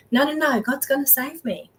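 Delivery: drowning man turns down the second boat; stubborn refusal. Retold or imitated. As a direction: shoo the rescuers off.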